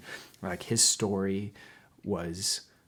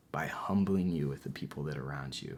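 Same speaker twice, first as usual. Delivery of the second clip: gentle whisper; 6 semitones lower, 6 dB quieter than usual. Retold or imitated. God speaking gently.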